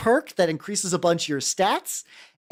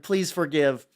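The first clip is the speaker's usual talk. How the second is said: ordinary talk, nothing marked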